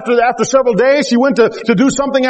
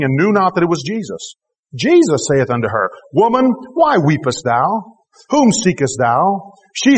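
scripture reading; 6 semitones lower than usual. Acted. Preacher reading John twenty to the congregation, measured and low. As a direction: read the passage with reverent calm.

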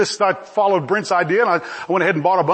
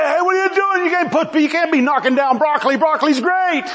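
mock upset growers; 8 semitones higher than usual. Broccoli growers upset at Bush.

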